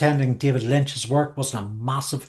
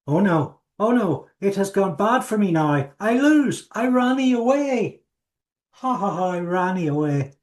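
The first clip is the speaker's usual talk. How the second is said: defeated villain whine; 6 semitones higher than usual. Pretend. Villain defeated, whining retreat.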